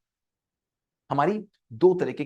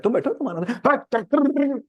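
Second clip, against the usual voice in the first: high, loud turn back to scolding; acted burst of temper; angry parent; 8 semitones higher, 3 dB louder, slower than usual. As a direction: round on the child. Turns back to scolding the child.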